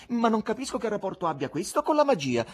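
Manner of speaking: high-pitched haughty objection. Acted. Wife challenges the magician's buffoon, very haughty. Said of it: "dichiarò con fare molto altero".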